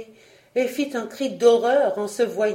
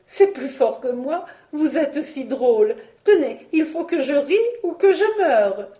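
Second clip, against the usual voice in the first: high, louder voice, climbing; helplessly amused girl; 6 semitones higher, 5 dB louder than usual. A narrator's reading. Marguerite excuses her giggles, high breathless-with-mirth pleading. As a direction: excuse yourself, voice climbing higher. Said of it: "elle fut prise d'un fou rire".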